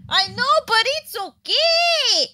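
slow, high, drawn-out plea; pleading reassurance. Real, not acted. Partner trying to calm the upset streamer, high pleading whine. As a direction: whine it back to soothe.

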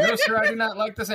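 loud protesting recruit; recruit reply. Acted. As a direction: answer like a scared recruit. Recruit answering.

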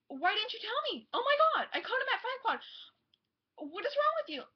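retold high, loud outraged yelling; angry yell. Daughter yelling at her mom over the missed band, high and loud. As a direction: blow up at mom for not telling you. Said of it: "I was, like, so mad".

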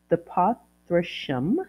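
normal talking voice

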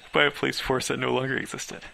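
high burst of laughter; laughing through the words. Real, not acted. Reader cracks up while reading the chapter.

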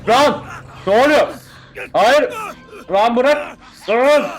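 panicked high-pitched shouts; scared yelling. Real, not acted. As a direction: yell out in sudden fright. Sudden horror scare in game.

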